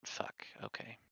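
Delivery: whispered curse under breath; breathy frustrated whisper. Real, not acted. Frustrated after file saved unexpectedly.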